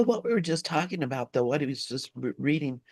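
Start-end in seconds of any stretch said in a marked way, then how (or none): none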